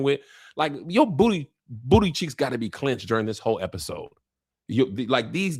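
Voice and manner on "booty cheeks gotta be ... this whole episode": ordinary delivery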